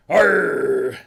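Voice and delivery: loud, drawn-out pirate growl; hammed-up pirate roar. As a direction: bellow it like a movie pirate. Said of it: "walk around all day tomorrow going".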